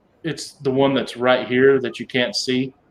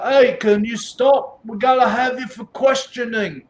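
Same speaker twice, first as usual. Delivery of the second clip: calling guard voice; 9 semitones higher than usual. Acted. Guard calls after party.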